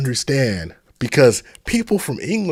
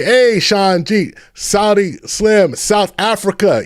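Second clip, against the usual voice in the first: slow, high, louder name calls; drawn-out shout-outs; 8 semitones higher, 4 dB louder, slower than usual. Real, not acted. Streamer shouting out viewers, slow and excited. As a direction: stretch each name out like a roll call. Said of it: "shouting out viewers on live".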